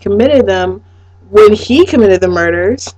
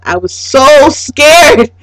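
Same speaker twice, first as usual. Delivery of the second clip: mock scared; 7 semitones higher, 4 dB louder, much slower than usual. Real, not acted. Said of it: "I was petrified".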